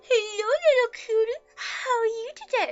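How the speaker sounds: put-on high, loud cooing baby talk; mock baby voice for a pet. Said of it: "speak to their animals in a baby voice".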